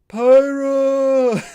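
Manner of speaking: high, loud drawn-out name call; imitated bad-dub shout. Podcaster mocking Rex's English dub, hollered shout. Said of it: "instead of".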